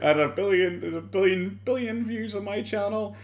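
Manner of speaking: hint of a mocked YouTuber bragging to mom; mocking boastful quote. Mimics Boogie boasting to mom.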